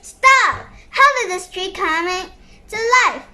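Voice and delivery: loud alarmed yell; shouting. Acted. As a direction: stop them with a shout. Shouting at monster tree.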